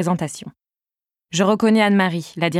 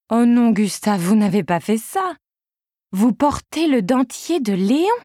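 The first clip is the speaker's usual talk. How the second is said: higher, slowing on the final question; shocked disbelief; 4 semitones higher than usual. Acted. Receptionist catching Gustave wearing Léon's dentures, incredulous. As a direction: gasp it out, appalled and amused. Isabelle discovers the prank.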